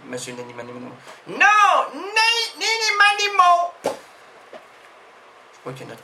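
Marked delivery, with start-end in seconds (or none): 1.9-4.0 high mock crying of a name; mock wail. Character wailing his name at the host in mock tears. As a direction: whimper the name in fake distress.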